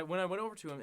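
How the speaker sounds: ordinary talk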